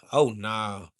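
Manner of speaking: usual speaking voice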